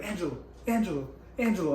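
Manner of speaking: imitating his girlfriend's urgent hiss of his name; a lightly voiced girlfriend, quick and pressing; girlfriend calling out. Retold girlfriend urgently calling her boyfriend in the elevator, mock urgent call.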